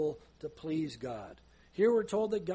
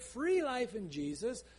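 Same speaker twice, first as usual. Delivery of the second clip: ordinary delivery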